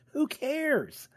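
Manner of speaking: put-on very high-pitched kid voice. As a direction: squeak it like a dismissive teen. Kid friend quoted dismissively.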